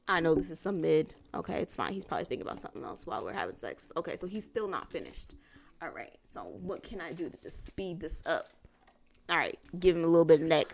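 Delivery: low, hushed thinking to oneself; resigned inner monologue. Retold or imitated. Woman thinking to herself during dragging sex, resigned undertone.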